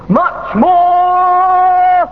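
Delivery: high, very slow held-out radio cry; mock prophet's proclamation. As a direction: stretch each word long on a high note. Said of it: "In the words of the immortal prophet".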